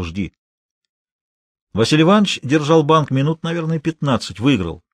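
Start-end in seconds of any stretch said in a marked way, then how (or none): 0.0-0.3 low, soft, clipped single word; one cold word, lower and softer; curt order. A narrator's reading. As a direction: toss it off without looking. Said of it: "коротко бросил".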